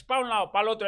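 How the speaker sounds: ordinary delivery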